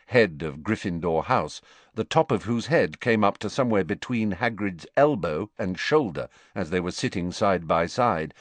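ordinary delivery, nothing marked

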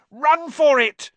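slow, high, loud shout. Acted. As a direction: shout the order to flee. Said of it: "Ron shouted".

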